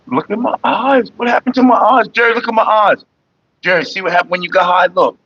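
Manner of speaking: high excited look-at-this voice; excited high exclaiming. Real, not acted. Showing his stoned eyes.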